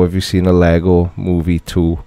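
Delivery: normal talking voice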